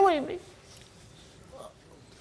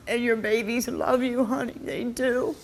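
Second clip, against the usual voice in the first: usual speaking voice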